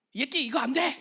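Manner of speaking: a hint of a stern scolding; mock scolding. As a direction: snap it as an angry reprimand. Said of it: "이렇게 하지 않으셨어요".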